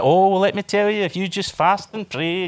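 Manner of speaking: lightly voiced pious advice-giver; mock holy joe. Holy joe advising someone in hardship, lightly mocked. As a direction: draw out the advice with mock piety. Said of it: "one of the holy joes that quotes scripture".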